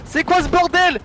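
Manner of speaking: high, stunned outcry; yelling in disbelief at the play; shocked disbelief. Real, not acted. Reacting to pentakill.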